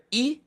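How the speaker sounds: "E" high, a long pure ee; ee sound. Shown as a demonstration. Teacher has class say it together, ee sound. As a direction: model "E" as a high ee vowel. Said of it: "is pronounced, let's say it together".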